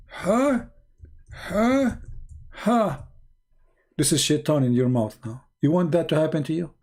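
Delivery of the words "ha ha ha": high, cackling devil laugh in a voice not his own; devil's mocking laugh. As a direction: laugh like Satan mocking the one who yawns. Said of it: "We don't want ha, ha in Arabic".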